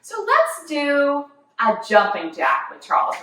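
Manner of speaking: ordinary delivery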